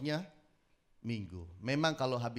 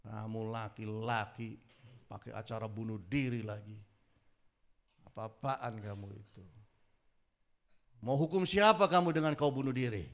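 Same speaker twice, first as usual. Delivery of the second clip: low, measured telling-off; understated acted reprimand; retold scolding; 7 semitones lower than usual. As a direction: scold him sternly without shouting, building at the end. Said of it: "saya marahi dia".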